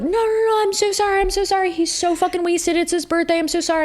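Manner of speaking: high, squeaky, pleading apology; frantic apologetic friends. Retold or imitated. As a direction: play the panicked friend begging forgiveness.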